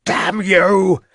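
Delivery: high, loud, cracking curse; furious yell. A narrator's reading. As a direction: spit it out at full strength. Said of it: "yelled Mountcourt".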